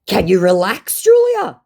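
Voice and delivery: high, loud mock protest; hammed-up exasperation, shooting up on the name; scammer voice. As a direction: act a flustered scammer.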